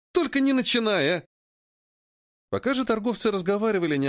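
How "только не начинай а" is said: high-pitched exasperated plea; whining protest at a familiar remark. Acted. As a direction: cut the companion off, pitched well up. Exasperated companion cuts off a stock saying.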